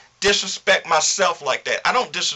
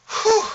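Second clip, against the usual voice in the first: high whew of relief. Real, not acted. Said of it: "I had to get that out".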